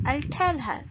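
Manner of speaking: "I'll tell her" with high, falling "tell"; stress on tell. Shown as a demonstration. Teacher showing another sentence, stress on "tell". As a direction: hit "tell", reduce "I'll". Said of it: "we put the stress on tell".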